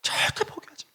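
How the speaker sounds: high whisper choked with tears; a leader urging a broken member not to give up, in a high tearful whisper; tearful encouragement. Retold or imitated. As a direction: whisper the comfort through sobs. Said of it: "엉엉 운 거예요".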